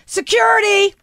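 imitating an officer summoning backup; mock call for security. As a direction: call out for the guards. Agent calling security in the story.

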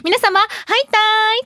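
high, cheery stage greeting; demonstrated, high-pitched promotional catchphrase; campaign lady greeting. Shown as a demonstration. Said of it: "那覇観光キャンペーンレディー、".

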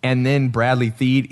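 usual speaking voice

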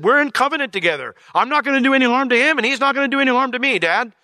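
high, loud, fast standoff; high, loud, fast defiant answer from a son to his angry father. Retold or imitated. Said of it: "I said".

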